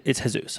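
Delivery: "Jesus" corrected to heh-SOOS; Spanish pronunciation. Retold or imitated. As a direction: say "Jesus" with H and final oos. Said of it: "butchering your name".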